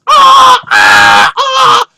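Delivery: two people shrieking back and forth; screaming together. Retold or imitated. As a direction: keep screaming in mutual panic. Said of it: "we just scream for several seconds".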